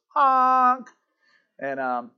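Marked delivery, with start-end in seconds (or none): 0.1-0.9 high, drawn-out comic honk; one long silly honk; nose-honk noise. Retold or imitated. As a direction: imitate a horn as you tweak a nose. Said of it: "grabs his nose and goes".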